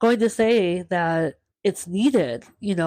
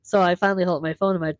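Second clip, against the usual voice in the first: ordinary talk, nothing marked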